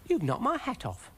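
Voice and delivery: high-pitched, put-out little snail; high, affronted cartoon creature; indignant snail. Brian the snail complains.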